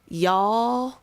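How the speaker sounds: slow Southern drawl on y'all; a touch of Southern drawl on y'all. Retold or imitated. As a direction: stretch y'all like a Texan. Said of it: "with a little bit of a southern drawl".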